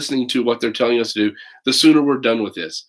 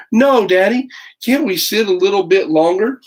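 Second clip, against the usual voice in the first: put-on pleading child; 8 semitones higher, 6 dB louder than usual. Child Matthew voiced in story.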